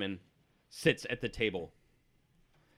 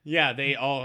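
normal talking voice

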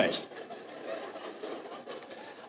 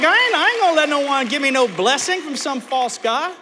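high-pitched mock defiance; worked-up retold refusal, pitched high; retold going off; 6 semitones higher than usual. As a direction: act out indignantly going off. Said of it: "I just start going off".

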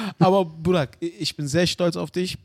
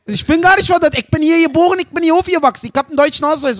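loud, high Berlin-dialect protest; mock-outraged Berliner; Berlin dialect rant; 8 semitones higher, 5 dB louder than usual. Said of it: "Guck mal, wie du redest".

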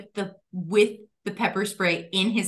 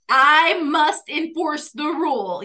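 slow, louder, higher mock proclamation; mock self-righteous; 4 semitones higher, 5 dB louder, slower than usual. Imitating a driver upset about rule breakers, mock self-righteous. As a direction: declare it like a solemn rule enforcer.

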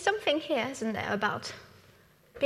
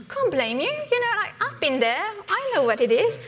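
mock excuse-making Joseph; 5 semitones higher, 6 dB louder than usual. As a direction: ham up the brothers-excusing Joseph as a joke. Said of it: "He doesn't say".

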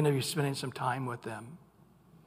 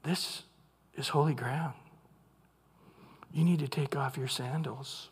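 soft, low and reverent, with long pauses; quiet, weighty divine instruction; hushed solemnity; 4 semitones lower, 8 dB quieter than usual. Acted. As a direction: drop to a grave hush on sacred ground. God's solemn words after the shout.

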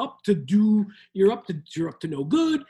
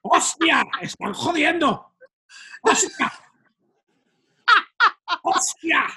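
put-on accent from Spain, exclamations pitched up; Castilian Spanish accent; 4 semitones higher than usual. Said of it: "that's one good accent that you got going".

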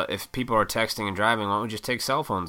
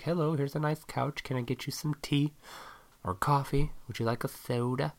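higher, softer, polite hostess; prim host voice; 7 semitones higher, 4 dB quieter than usual. Pretend. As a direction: offer tea like a prim host showing off the nice room.

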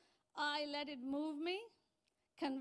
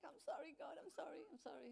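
quiet apology; 3 semitones higher, 9 dB quieter than usual. Retold or imitated. Apologising to God.

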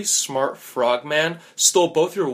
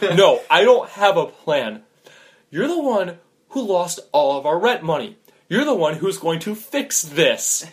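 raised, loud, emphatic rebuke; exasperated scolding; 5 semitones higher, 5 dB louder than usual. Acted. Friend blaming gambler for lost rent, exasperated. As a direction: lay the blame with a higher, raised voice.

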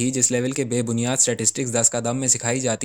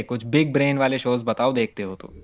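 normal talking voice

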